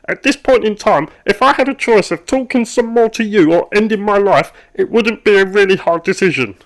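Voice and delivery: high, loud exasperated rant; angry outburst. Acted. Worker whose lunch went cold snaps at chatty colleague, angry. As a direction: finally snap and let it all out.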